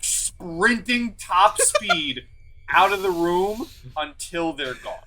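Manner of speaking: ordinary delivery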